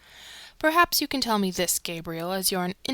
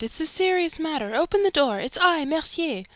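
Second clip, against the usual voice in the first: retold shout; 7 semitones higher, 4 dB louder than usual. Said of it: "I shouted".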